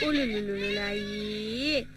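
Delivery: low, slow, soft trilled cooing; drawn-out soothing babble; baby-soothing lullaby babble. Acted. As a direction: hush a baby with rolling nonsense sounds.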